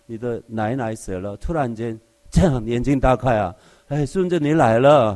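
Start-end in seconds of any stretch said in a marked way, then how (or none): 3.9-5.1 sudden loud greeting from a dead grandma, louder; startled greeting from a waking corpse, loud; dead grandmother waking. Pretend. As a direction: call out like a corpse sitting up.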